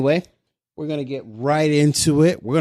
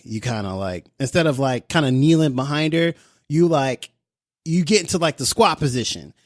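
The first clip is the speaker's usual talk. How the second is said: normal talking voice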